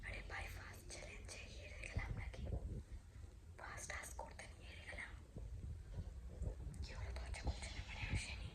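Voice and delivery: frightened hushed whisper; scared whisper. Real, not acted. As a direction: whisper tensely, afraid of discovery. Hiding in fear, says scared.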